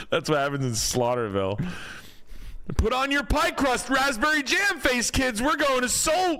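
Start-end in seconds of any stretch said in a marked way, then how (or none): 2.8-6.4 put-on high, bright kids announcer; high goofy voice.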